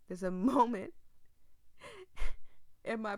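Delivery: normal talking voice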